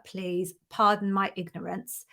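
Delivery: normal talking voice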